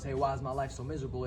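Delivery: usual speaking voice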